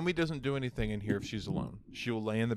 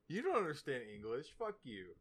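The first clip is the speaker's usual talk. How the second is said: soft, higher-pitched dog talk; sweet talk to dog; 5 semitones higher, 5 dB quieter than usual. Shown as a demonstration. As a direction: coo the insult gently as if praising a dog. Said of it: "They understand inflection and tones".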